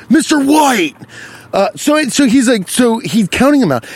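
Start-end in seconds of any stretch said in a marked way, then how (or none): 0.1-1.0 high, slow whine of a name; Jesse Pinkman. Acted. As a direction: call out the teacher's name, high and drawn out. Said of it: "it sounded like Jesse Pinkman".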